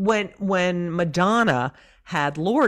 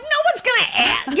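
much higher, louder protest; exasperated retort; 4 dB louder than usual. Retold or imitated. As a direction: throw up your hands in disbelief. Arguing with mother about Henry.

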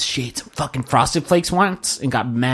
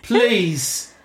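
put-on high, pleading Irish accent; mock Irish roommate; 10 semitones higher, much slower than usual. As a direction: beg in a whiny Irish voice. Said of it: "That old Irish folk song".

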